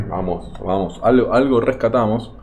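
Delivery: ordinary delivery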